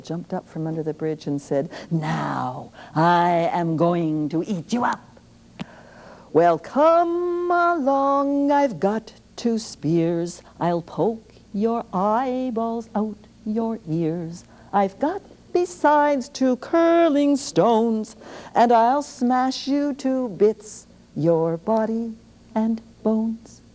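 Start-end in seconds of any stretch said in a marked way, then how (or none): 1.9-5.0 troll threat. Acted. Troll threatens big goat. 6.3-23.6 slow, booming dare; big goat's challenge. Acted. Big goat daring the troll to come at him, slow and bold.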